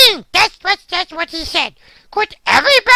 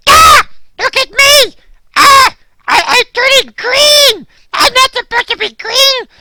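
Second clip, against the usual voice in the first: panicked shout; 3 semitones higher, 5 dB louder than usual. Acted. Dog shouting at viewers as he turns green. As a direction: yell it in horror.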